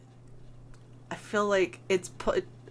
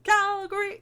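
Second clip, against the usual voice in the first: high, loud ring announcer shout; wrestling announcer; 12 semitones higher, 6 dB louder than usual. Shown as a demonstration. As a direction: belt the city name like a 90s wrestling announcer. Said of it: "it's mostly the announcer going".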